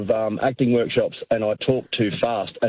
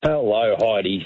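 ordinary talk

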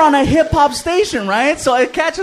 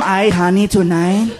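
flirty wife; 5 semitones lower than usual. Retold or imitated. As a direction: coax him like a wife at bedtime. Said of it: "And she tells him".

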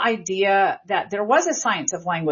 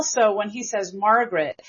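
ordinary talk

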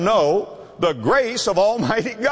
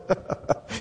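quiet unvoiced chuckle; a hushed little laugh; soft breathy laugh; 7 dB quieter than usual. Father laughing at his son's news, soft chuckle. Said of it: "he begins to laugh".

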